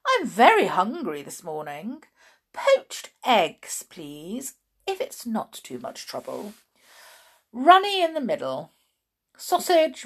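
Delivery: loud, high-pitched, grandly hungry tramp; Mister Stink. A narrator's reading. Mister Stink ordering breakfast from Chloe, loud and grand. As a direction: order breakfast with great relish.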